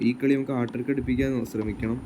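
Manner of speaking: normal talking voice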